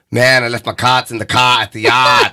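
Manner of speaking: broad fake Boston accent with dropped R's; put-on Boston accent. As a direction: lay the Boston accent on thick. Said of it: "talk like you're from Boston and you're not from Boston".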